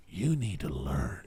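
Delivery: low, slow, measured warning; mock stern lecture. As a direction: lay it down slowly and softly, like a lesson. Acts out firmly schooling someone.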